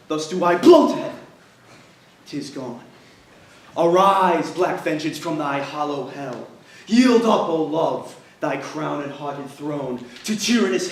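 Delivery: loud, raging tragic speech; furious Othello. Acted. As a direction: rage at the heavens. Othello vows revenge.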